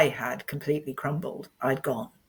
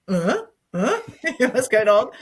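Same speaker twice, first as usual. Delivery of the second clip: loud, much higher baffled uh, uh, with a laugh; confused friends; 6 semitones higher, 7 dB louder than usual. Retold or imitated. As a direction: play the puzzled friends, half laughing. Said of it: "They're all like".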